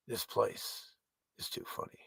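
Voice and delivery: whispered aside. Real, not acted. Musing to viewers, whispering an aside. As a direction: drop to a breathy whisper.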